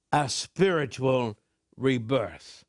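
normal talking voice

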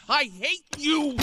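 high, drawn-out cry of hatred; furious outburst. Acted. Betrayed young inmate to the convict who framed him, furious. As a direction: fling it at him, slow and high.

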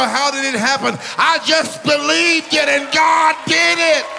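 rising revival preaching; preaching building to a shout. Acted. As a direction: build it up and land it hard.

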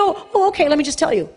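high, eager mock-naive voice; mock naive agreement. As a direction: play the gullible fool cheerfully. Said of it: "who's gonna be".

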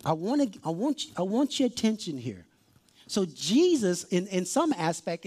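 ordinary talk, nothing marked

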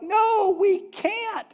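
high, loud panicked cry of refusal; mock-frightened whine of refusal; panicked Israelites. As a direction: squeal it out like scared people backing off. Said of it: "they're like".

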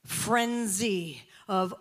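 normal talking voice

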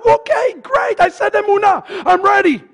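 high-pitched over-keen imitation; mock eagerness. Preacher mimicking eager congregant learning Hebrew, mock eagerness. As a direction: play the instantly convinced learner. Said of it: "You all were much more excited".

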